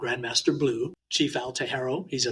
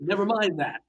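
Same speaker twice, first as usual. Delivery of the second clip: higher-pitched, imitating an elder waving off an apology; lightly voiced older man brushing it off; dismissive elder; 5 semitones higher than usual. Older Hawaiian master tells the young man to sit, mock dismissive. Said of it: "he says".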